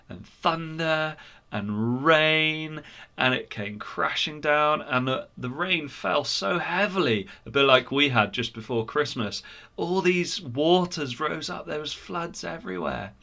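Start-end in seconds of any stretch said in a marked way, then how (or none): none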